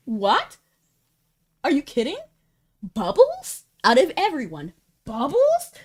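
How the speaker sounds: high, loud incredulous cries; can't believe they'd do this to Bubbles; shocked disbelief. Real, not acted. Fan reacting to a leaked script, shocked and high.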